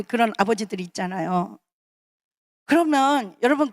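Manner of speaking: ordinary talk, nothing marked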